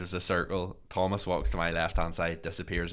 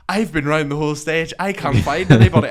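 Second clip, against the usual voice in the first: higher, loud complaint, building; retold frustration, voice raised; acted exasperated report; 6 semitones higher, 11 dB louder than usual. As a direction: imitate a flustered searcher at wits' end. Thomas returns from searching, acted.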